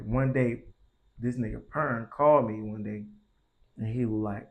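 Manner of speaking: ordinary delivery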